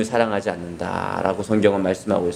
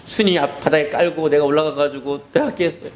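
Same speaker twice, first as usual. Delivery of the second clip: put-on higher, tearful child's apology; mock-tearful child confession; acted crying confession; 5 semitones higher than usual. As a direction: confess like a sorry crying kid. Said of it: "막 울면서".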